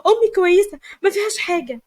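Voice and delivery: high, loud desperate scream; screaming in denial. A narrator's reading. Daughter screams at the nurse who announces her mother's death, loud and high. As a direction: scream it, refusing the news. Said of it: "تمارا بقت تصرخ وتقول".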